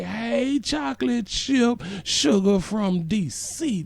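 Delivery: high, slow crooning hello; drawling high-pitched flirty shout-out; sweet-talk greeting. Real, not acted. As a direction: draw out the pet names up high. Greeting a viewer by pet name.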